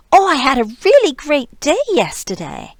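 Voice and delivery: high, loud and bright with excitement; gushing, happy delivery; excited tone. Shown as a demonstration. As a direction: sound thrilled about your day. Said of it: "I'm saying it with a very different, um, prosodic tone".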